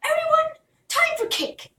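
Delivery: very high, loud royal announcement; proclaiming. Acted. Princess announcing cake to everyone, high and loud. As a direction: call the whole court. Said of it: "she stood up and proclaimed".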